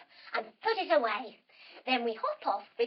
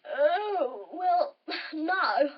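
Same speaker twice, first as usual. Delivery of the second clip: speaking through a mock yawn; drawn-out, yawny croc reply; yawning crocodile; 2 semitones higher, 3 dB louder, much slower than usual. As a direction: yawn into the words lazily. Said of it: "The crocodile yawns again and says".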